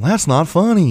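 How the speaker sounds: high-pitched mock scolding; Jerry Seinfeld. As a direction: scold the audience in a comedian's voice. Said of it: "Jerry keeps looking at the audience going".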